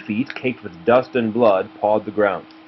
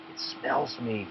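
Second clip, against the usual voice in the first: softly, under the breath; hushed inner voice; quiet thought; 12 dB quieter than usual. A narrator's reading. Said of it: "he thought".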